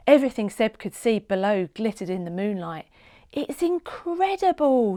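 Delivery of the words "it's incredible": higher, slow, full of wonder; girl flying over the sea in wonder; awed exclaim. A narrator's reading. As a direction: breathe it out in amazement at the view. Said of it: "she exclaimed".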